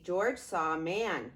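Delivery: ordinary delivery, nothing marked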